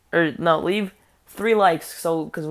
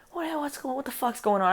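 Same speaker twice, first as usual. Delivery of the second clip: startled; 7 semitones higher than usual. Retold or imitated. Shocked at kids' antics.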